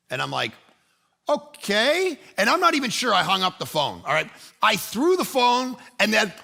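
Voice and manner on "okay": baffled okay. Retold or imitated. Retold baffled reply to police.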